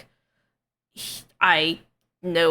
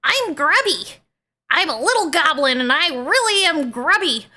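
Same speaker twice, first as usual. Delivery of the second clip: high, loud little gremlin goblin; high-pitched gremlin goblin voice; 10 semitones higher, 6 dB louder than usual. Shown as a demonstration. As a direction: squeal it out like a grubby little goblin. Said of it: "that's kind of like a goblin voice".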